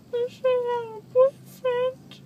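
crying. Real, not acted. Groggy daughter after surgery, crying.

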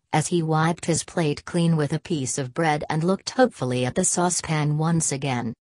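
plain reading voice

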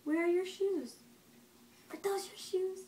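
put-on quiet, gentle questions to a baby; soft parent voice. Parent quietly quizzes the baby on body parts and objects. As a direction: ask softly, near a whisper.

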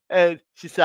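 usual speaking voice

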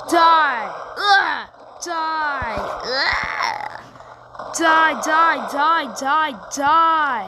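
loud repeated battle yells; yelling die. Real, not acted. Gamer yelling at mobs while fighting, loud shouts. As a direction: bark each die at the enemy.

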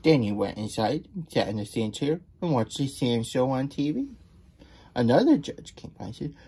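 ordinary delivery, nothing marked

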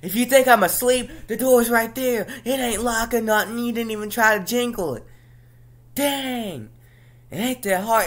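high, loud exasperated rant; indignant yelling. Retold or imitated. Telling off someone who barged into the bedroom, indignant yelling. Acted row about barging in.

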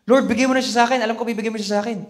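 confident believer. Pretend. Voicing faith.

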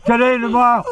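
high-pitched, tickled repeat; amused punchline repeat. Real, not acted. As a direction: relish the punchline between laughs. Repeats punchline while cracking up.

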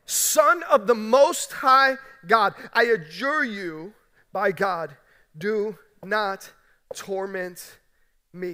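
loud, slow, drawn-out cry of a demon; the demon cries out loud and slow, pleading. Acted. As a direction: cry it out slowly with full force, begging. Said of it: "cries out again with a loud voice".

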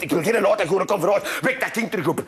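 usual speaking voice

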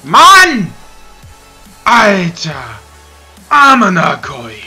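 berserk rage. Real, not acted. Raging at missed chance.

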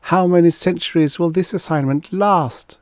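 high-pitched impatient female character; groaning complaint. A narrator's reading. She complains to her companion about the assignment, groaning. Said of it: "she groaned".

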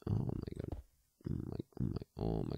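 breathless whispered oh my god; shocked whisper. Real, not acted. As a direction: gasp it out under your breath. Shocked at something in game.

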